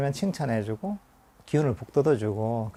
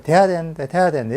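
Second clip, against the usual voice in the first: fretting; 4 semitones higher, 7 dB louder than usual. Retold or imitated. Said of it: "자꾸 그냥 안달하는 거예요".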